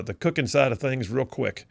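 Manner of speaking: ordinary talk, nothing marked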